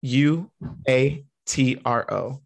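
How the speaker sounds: each letter said slowly; slowly spelled. Shown as a demonstration. Showing her friend the Spanish word, spelled slowly. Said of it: "the number four in Spanish".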